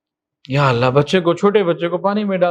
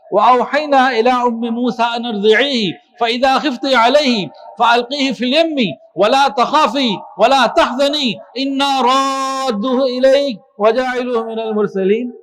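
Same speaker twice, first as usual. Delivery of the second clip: melodic tilawat chant, a touch higher; Quran recitation; 4 semitones higher than usual. Acted. Preacher reciting the verse to his congregation, chanted.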